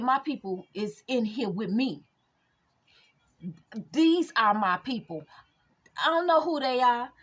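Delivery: loud preacher-style rebuke as Jesus; Jesus rebuking. Retold or imitated. Jesus tells the crowd off in a loud preaching voice. Voicing Jesus in retold Bible story.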